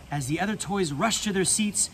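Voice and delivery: ordinary delivery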